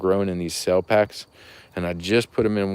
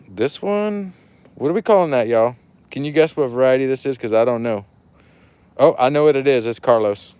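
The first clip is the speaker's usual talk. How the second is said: excited; 5 semitones higher, 7 dB louder than usual. Real, not acted. Excited guessing the variety.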